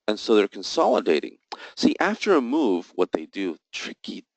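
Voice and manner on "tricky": under-the-breath unvoiced aside, much softer; sly muttered aside about market makers; muttered insult. Real, not acted. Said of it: "excuse me, I didn't say that".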